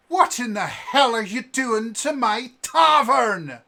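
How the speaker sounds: loud, high furious yell; raging landlady bellowing; furious tavern keeper. Acted. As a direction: scream down the brawlers in her tavern. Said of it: "this cold fury in her eyes".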